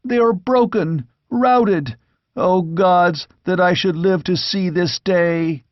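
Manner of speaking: put-on high, despairing young voice; anguished lament. A narrator's reading. Squire laments the rout before the king, high anguished voice. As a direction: mourn the lost battle aloud. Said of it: "cried the squire".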